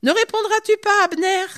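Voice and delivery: loud, high call across a distance; appel crié. Acted. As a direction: holler a challenge to the far hill. David shouts across to Abner. (cri d'interpellation, fort et aigu)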